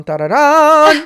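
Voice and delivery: slow, high, loud sung dramatic "dun dun"; dramatic sting. Pretend. Host marking the big quote, mock dramatic sting. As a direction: make a mock movie sound effect. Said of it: "create a dun".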